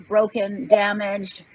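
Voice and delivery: ordinary delivery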